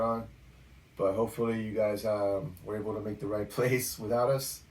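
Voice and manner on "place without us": normal talking voice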